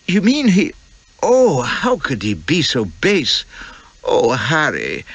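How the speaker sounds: put-on a wounded, breathy young bride; Elspeth, shocked. Young wife hearing of the villain's lies, slow and dismayed.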